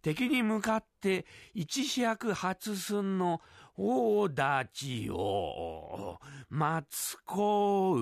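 stumbling word by word, very slow, oddly voiced; halting slow reading. Barbershop customer struggling to read a book aloud, very slow and halting. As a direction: sound out each syllable like a poor reader. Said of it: "読めてねえよ".

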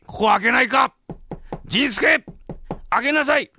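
loud, high-pitched order through the door; angry master shouting. Acted. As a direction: holler for the servant to open up. Locked-out master shouts for servant.

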